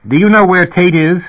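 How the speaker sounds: loud, high call down the corridor; calling after someone. Acted. Official calling after departing Herb, loud high call. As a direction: raise the voice to reach someone walking away. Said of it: "called after Herb Asher".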